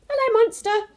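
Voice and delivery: put-on chirpy young Bernard greeting; child's voice. Bernard calls out hello to his busy dad.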